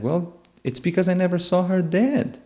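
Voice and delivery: put-on high, insistent child's answer; defiant child's retort. Child explains to father why they don't believe him, high-pitched defiance.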